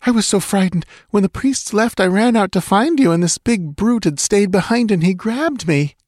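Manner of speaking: high-pitched scared woman's voice; frightened explanation. A narrator's reading. Frightened woman explains her ordeal.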